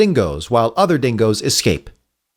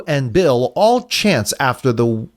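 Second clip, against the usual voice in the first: usual speaking voice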